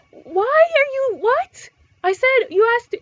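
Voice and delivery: high, loud indignant disbelief; exasperated outburst. Real, not acted. Venting to viewers about rude pet comments, loud and high. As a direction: blurt it out, fed up with people. Outraged at people's reaction to pets.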